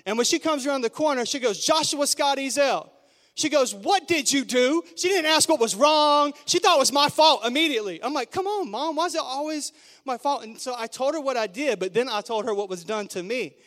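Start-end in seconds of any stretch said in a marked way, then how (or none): 1.6-2.9 loud, higher mom calling out full name; the full-name warning every kid fears; mother bursting round the corner, calling her son sharply. Retold or imitated. 3.7-4.8 loud, slow, demanding question; accusing mother sure it's his fault; accusing mom. Retold or imitated. 8.3-10.4 put-on much higher, pleading kid whine; a boy protesting it's unfair; whiny kid protest.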